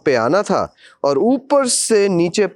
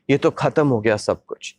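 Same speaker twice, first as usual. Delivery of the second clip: ordinary talk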